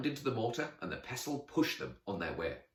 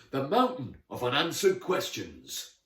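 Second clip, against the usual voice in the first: higher, louder knightly proclamation; grand announcement; 5 semitones higher, 6 dB louder than usual. Acted. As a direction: give the place name full dramatic weight.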